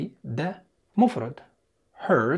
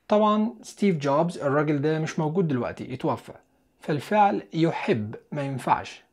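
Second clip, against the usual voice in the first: ordinary talk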